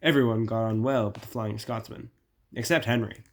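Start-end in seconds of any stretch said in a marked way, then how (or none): none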